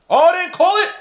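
very high, loud disbelief; outraged shout. Real, not acted. Fan protesting an uncalled travel, outraged shout. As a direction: yell it at the referees.

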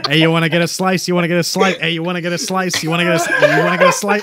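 rapid-fire Brooklyn patter, low; Brooklyn accent. Acted. Everyone outside offering pizza, quick repetitive Brooklyn accent. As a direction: pester like a Brooklyn local. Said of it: "speaking in a Brooklyn accent".

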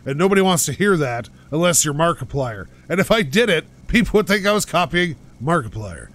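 ordinary talk

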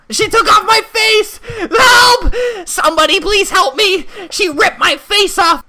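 high, loud mock screaming panic. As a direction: scream for help in fake horror. Salon strips his avatar.

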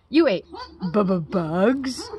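halting, slowed stutter on bu-bu-bugs; a storybook bat stammering in disbelief. A narrator's reading. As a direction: trip over the word bu-bu-bugs. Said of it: "stuttered one".

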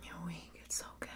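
usual speaking voice

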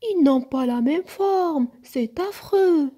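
high, loud grouchy whine; grumpy growling complaint. A narrator's reading. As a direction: complain loudly, dragging out the dismay. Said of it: "grogna Nook".

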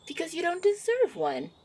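a hint of a pouty tease; teasing refusal. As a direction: toss it back with mock sass.

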